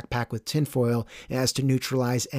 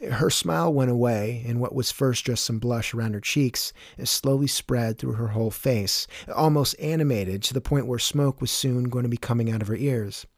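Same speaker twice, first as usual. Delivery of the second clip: usual speaking voice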